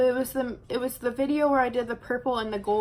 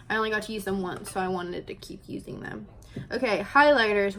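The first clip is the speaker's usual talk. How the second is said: ordinary delivery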